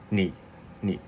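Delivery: 你 short and low; natural third tone. Shown as a demonstration. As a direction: say 你 with a simple drop, no rise. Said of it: "That's a third tone".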